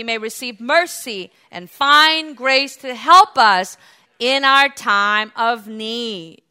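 loud, declamatory preaching; full-force pulpit reading; forceful scripture reading. Real, not acted. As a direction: proclaim the verse, stretching the key phrases.

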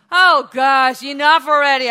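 exasperated parent yelling enough already; exasperated shout. Acted. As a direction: shout it out, at the end of your rope. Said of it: "God probably rolling his eye".

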